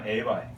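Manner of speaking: "Eibai" as "ay bye"; pronunciation model. Shown as a demonstration. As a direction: model "Eibai" for repeating, ending like "buy". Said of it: "I'm gonna say it".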